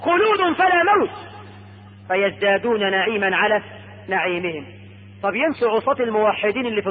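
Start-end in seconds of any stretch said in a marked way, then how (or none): 0.0-1.3 high, sustained proclamation; calling out. Acted. Caller announcing eternal life in Paradise, proclaiming. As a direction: proclaim it like a herald. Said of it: "ثم ينادي المنادي".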